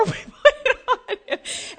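loud, high, helpless laughter in the words; laughing. Real, not acted. Mother recalling the flower fix, laughing real hard. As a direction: laugh your way through it.

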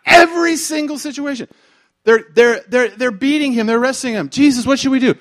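high, hammered delivery; full-force preaching. Real, not acted. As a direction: build the disciples' alarm at full volume.

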